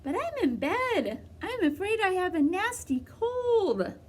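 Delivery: wolf pretending to be a sick grandmother; high fake-granny croak; scratchy voice. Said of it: "came a scratchy voice".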